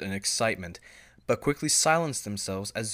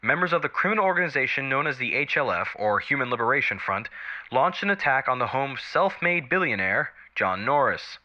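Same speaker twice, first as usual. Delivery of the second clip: crisp, louder anchor voice delivering the lead; TV news read; 3 semitones higher, 6 dB louder than usual. Acted. News anchor reporting an attack to viewers, crisp news read.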